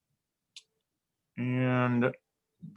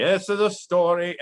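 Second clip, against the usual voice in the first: a lightly voiced storyteller, slightly raised and louder; hint of a dramatic storyteller; Zolf impression; 7 semitones higher, 5 dB louder than usual. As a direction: begin the tale like a grand storyteller, half-hearted. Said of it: "I can't do a good Zolf impression".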